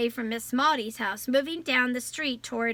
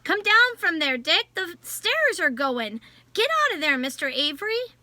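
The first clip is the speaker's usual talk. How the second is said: higher, louder urgent shout from the street; louder, higher, alarmed shouting to the man upstairs; 5 semitones higher, 4 dB louder than usual. A narrator's reading. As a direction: yell up at him to get out. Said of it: "men shouted".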